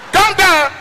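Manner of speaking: angry Yoruba man yelling at the driver; shouting calm down. Retold or imitated. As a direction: yell it way up high to stop the fight. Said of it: "In the shout".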